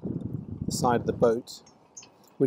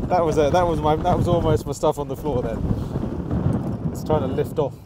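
breathless excitement; 4 semitones higher, 6 dB louder than usual. Real, not acted. Kit nearly blown over.

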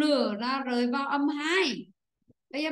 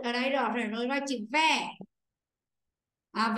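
ordinary talk, nothing marked

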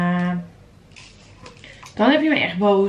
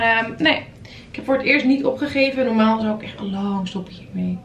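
ordinary delivery

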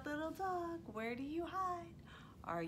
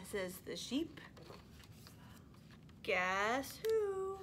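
lively, sing-song storytime reading; animated picture-book read-aloud; reading to toddlers; 3 semitones higher, slower than usual. Acted. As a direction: read it like you're holding the book up for little ones.

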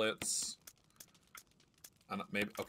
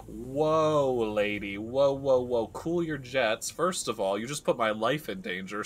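exasperated protest; 5 dB louder than usual. Retold or imitated. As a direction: push back hard, stretching "cool your jets". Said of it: "And I'm like".

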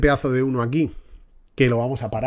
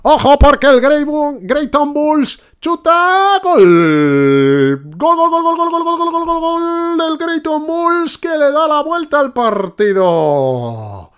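shouted, much higher drawn-out gol chant; breakneck goal commentary; 7 dB louder, much slower than usual. Pretend. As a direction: yell it like a radio commentator.

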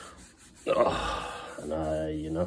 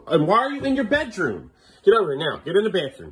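high, exasperated parental order; sharp raised-pitch telling-off; real scolding; 6 semitones higher than usual. Real, not acted. Scolding child out of bedroom.

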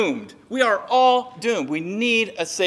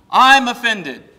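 loud, drawn-out offended outburst; mock indignation; 3 semitones higher, 9 dB louder, slower than usual. Worldling answers the truth, loud mock indignation. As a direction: blurt it slowly as a hammed-up complaint.

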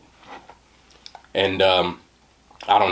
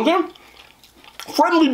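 high drawn-out question; much slower than usual. Real, not acted. Emphatic question.

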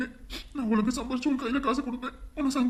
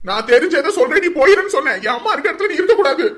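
loud, higher yelling; furious shout; 4 semitones higher, 12 dB louder than usual. Acted. Son screaming at sister to leave, furious shout. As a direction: scream the order at her.

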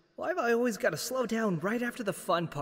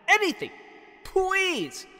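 desperate shouting; 9 semitones higher, 6 dB louder than usual. Acted. Character desperate for something to happen.

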